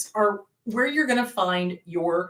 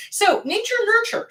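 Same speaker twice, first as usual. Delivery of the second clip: sing-song cue; 12 semitones higher, 4 dB louder than usual. Shown as a demonstration. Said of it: "teaching key terms".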